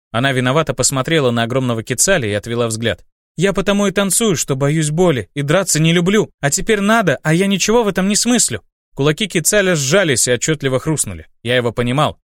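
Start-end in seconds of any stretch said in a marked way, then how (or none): 3.4-8.6 put-on higher, hesitant young woman; timid girl voice. Olga confessing fear.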